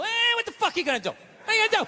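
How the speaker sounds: high, drawn "Eh" into a mock shrug; noncommittal response. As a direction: play someone bluffing a neutral answer. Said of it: "You gotta come with something neutral".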